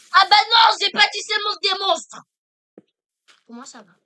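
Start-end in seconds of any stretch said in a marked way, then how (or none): 0.1-2.2 shouting. Real, not acted. Minecraft player yelling at bee.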